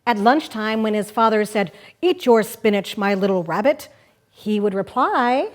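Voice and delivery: lively picture-book reading; reading to children. Acted. Host reading Pooh Bum aloud on the radio, playful storytelling. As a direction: read it like story time for kids.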